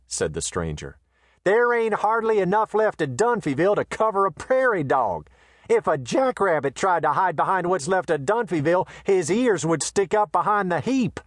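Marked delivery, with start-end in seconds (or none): 1.4-11.2 a swaggering Western tough, raised high; brash gunman voice. A narrator's reading. Voices the brash stranger Quay.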